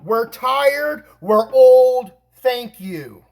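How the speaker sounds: high, loud, emphatic hypothetical reply; exasperated re-enactment. Retold or imitated. Argues what couple should have said.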